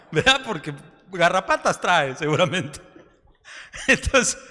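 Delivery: chuckling through the joke; laughing. Real, not acted. Pastor laughs at his ivermectin joke with the church. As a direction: laugh at your own joke.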